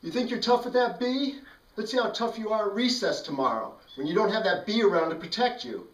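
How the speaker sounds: high, loud threatening yell; yelled back. Retold or imitated. Joe yelling a threat over his shoulder, high and loud. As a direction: shout the threat while running off. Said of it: "yelled back".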